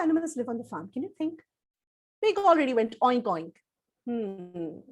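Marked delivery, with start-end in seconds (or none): none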